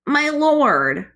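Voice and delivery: high, loud fawning creature; bow and fawn; groveling creature. Acted.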